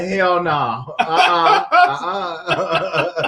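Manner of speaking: laughing through a mock hell no; giggling uh-uh, half-hearted; laughing refusal. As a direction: refuse while cracking up. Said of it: "She watched that video like".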